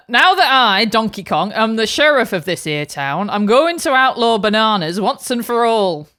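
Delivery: a hint of a northern English lawman; Lancashire accent. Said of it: "different British accent".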